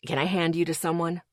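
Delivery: ordinary delivery, nothing marked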